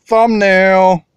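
loud called-out thumbnail; thumbnail callout. Acted. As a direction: call the shot to camera.

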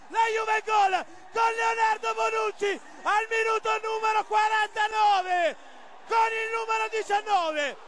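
shouting, stretched-out name chant; ecstatic goal commentary. Real, not acted. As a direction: roar the scorer's name. Celebrating Juve goal. (telecronaca esultante)

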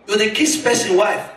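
usual speaking voice

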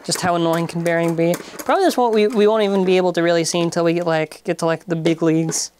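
usual speaking voice

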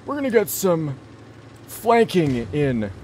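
put-on high, strained old man voice. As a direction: play it as a creaky old gamer. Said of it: "I'll play like him".

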